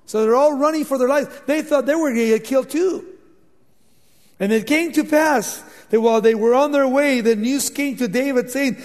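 ordinary talk, nothing marked

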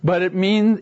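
ordinary delivery

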